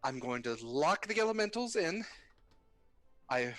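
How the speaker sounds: high, nervous relieved voice; lightly trembling rookie pilot; shaky young woman. Acted. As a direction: speak shakily after barely landing the train. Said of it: "She goes".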